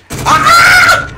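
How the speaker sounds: very high, loud real scream; sudden piercing scream; startled scream. Real, not acted. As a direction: shriek as if jumped by an enemy. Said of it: "He screamed too!".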